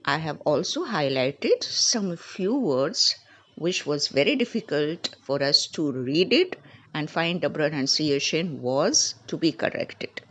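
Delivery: ordinary delivery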